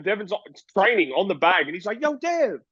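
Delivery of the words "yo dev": high imitated call across the gym; hammed-up shout to get his attention; mock call out. Bill calling over to Devin on the bag, mock yell. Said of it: "he yells out to Devin".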